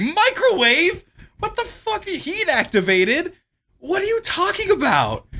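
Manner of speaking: high, drawn-out, stunned disbelief; can't believe what he's reading; incredulous disbelief. Real, not acted. To his co-host, reading about a heated toy, in high stunned disbelief.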